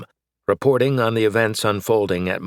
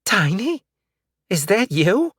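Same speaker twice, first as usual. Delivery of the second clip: put-on high, strained old woman; 12 semitones higher than usual. A narrator's reading. Old woman asking the dog, strained and high. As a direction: squeeze it out, unsure. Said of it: "she asked with a strained voice".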